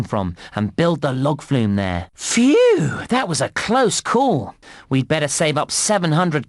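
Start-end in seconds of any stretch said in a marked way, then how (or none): 2.1-4.5 big high-pitched "phew" of relief; slow, exaggerated relieved reaction; acted relief. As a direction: let out relief, drawn out and pitched up. Game voice-over after danger passes.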